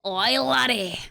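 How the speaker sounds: gruff mock Scottish accent; a quick jokey Scottish accent; short hammed-up Scottish accent. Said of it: "like, Scottish?".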